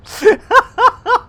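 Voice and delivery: high, loud burst of laughter; helpless laugh. Real, not acted. Streamer cracks up at their own joke, helpless laugh.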